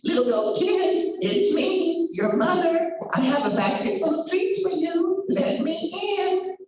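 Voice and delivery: high, sweet falsetto mother voice; wolf in sweet mother voice. Acted. Wolf at the door, faking the mother's sweet voice. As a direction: purr it sweetly like a loving mother. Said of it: "that sounded like their mother".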